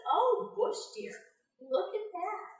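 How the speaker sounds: sly Anansi. Acted. Anansi tricks the deer.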